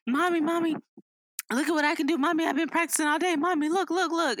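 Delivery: put-on high, insistent kid voice; high-pitched imitation of a pleading kid; eager child. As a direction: pester mommy to watch, like an excited child. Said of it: "the ones where it's like".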